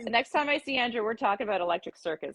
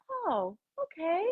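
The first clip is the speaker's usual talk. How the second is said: retold letdown; 8 semitones higher, 4 dB quieter than usual. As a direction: act quietly let down, pitched up high. Deflated reaction to stopped TV dancing.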